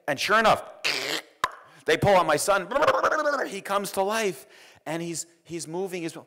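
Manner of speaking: high, loud burst of joy; joyful excitement. Retold or imitated. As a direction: let the relief ring out high. Joyful climax of birth.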